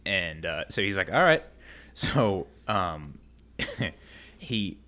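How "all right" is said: higher, louder imitated shrug-off; breezy acceptance. Retelling coworker's answer to a refusal, breezy acceptance. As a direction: pop it up high, imitating an easy okay.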